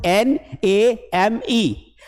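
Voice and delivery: letters N A M E spelled slowly one by one; slow dictation. Retold or imitated. Teacher dictating letters slowly to his class. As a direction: dictate each letter with pauses. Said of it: "तो मैंने कहा".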